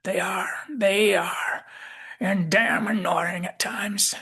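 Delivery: put-on slow, high, husky Southern accent; grudging old Southerner, drawn out; Southern McCoy. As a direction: concede it like a crusty Southern old-timer. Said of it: "he says".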